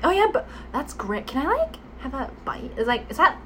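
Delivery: mock Gen Z hedging with curled tongue; Gen Z English. Shown as a demonstration. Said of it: "なんでちょっと舌巻いたみたいになってんの".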